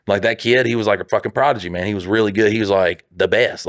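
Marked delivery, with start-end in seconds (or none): none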